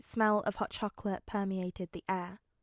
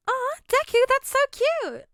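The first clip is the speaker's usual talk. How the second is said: much higher, loud coo; gushing coo; 8 dB louder than usual. A narrator's reading. A classmate cooing at Deku's dad, high and gushing. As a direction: coo over something adorable.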